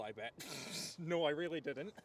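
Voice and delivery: ordinary delivery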